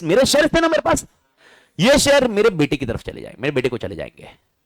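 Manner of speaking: ordinary talk, nothing marked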